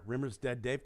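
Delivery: low, soft deadpan computer; Holly. Retold or imitated. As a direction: deliver bad news with no reaction, voice dropping. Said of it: "who's the ship's computer".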